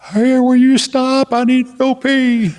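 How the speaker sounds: high, loud, slow whine; whiny Paul. Retold or imitated. Paul asking the driver to stop, slow high whine. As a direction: drag out the plea like a needy kid.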